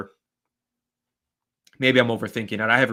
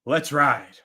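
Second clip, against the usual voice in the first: catchphrase sign-off; 3 semitones higher than usual. Acted. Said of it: "Giants Nation".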